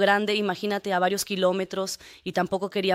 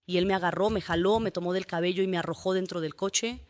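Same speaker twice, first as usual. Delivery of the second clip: ordinary delivery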